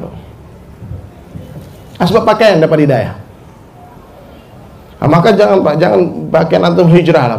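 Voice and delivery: ordinary delivery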